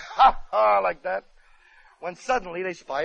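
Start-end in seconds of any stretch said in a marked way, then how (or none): 0.0-0.8 hearty mock bandit laugh; ha ha like a jolly bandit. Comedian shows how the bandits laugh, a hammed-up ha ha. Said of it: "all the bandits stand around laughing".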